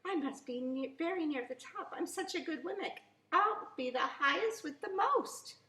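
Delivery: put-on a small wooden boy puffing himself up; proud little Wemmick. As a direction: Punchinello boasting to himself as he climbs, high and rising. Punchinello boasting, climbing alone.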